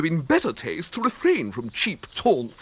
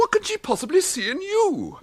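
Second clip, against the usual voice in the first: high scornful question; 13 semitones higher than usual. Acted. Gorringe scorning Bertie as Florence's choice. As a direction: sneer at him in disbelief.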